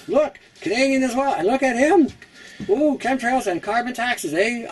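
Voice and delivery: hammed-up critter voice with a Canadian eh; voicing a beaver. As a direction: squeak it out like a shocked Canadian cartoon beaver. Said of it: "He's like".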